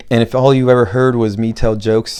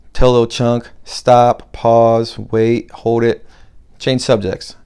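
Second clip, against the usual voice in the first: usual speaking voice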